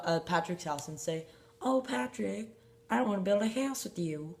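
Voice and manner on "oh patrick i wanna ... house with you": a light Southern twang, pitched up; lightly drawled Southern SpongeBob line; Southern accent. Pretend. SpongeBob asks Patrick to build, light Southern accent. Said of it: "that's a Southern accent".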